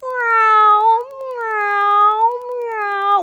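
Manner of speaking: high mewing kitty sounds; playful cat meows. Shown as a demonstration. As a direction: meow like a little cat for the kids. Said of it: "we're gonna meow".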